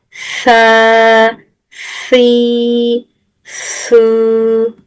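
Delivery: "Sa, si, su" long and lisped; drawn-out lisp. Shown as a demonstration. Teacher showing students the lengthened "Sa, si, su". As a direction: stretch each lisped "Sa, si, su". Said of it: "Uzattım arkadaşlar".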